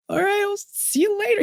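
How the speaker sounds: high, strained, awkward goodbye. Retold or imitated. To her boss after being let go, high awkward farewell. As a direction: squeak it out, not knowing how to leave. Awkward exit from firing meeting.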